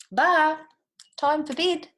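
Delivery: high, loud call to bed; George calling high. A narrator's reading. George calling Bar up to bed, high and loud. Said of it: "said George".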